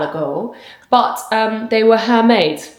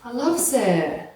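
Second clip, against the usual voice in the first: usual speaking voice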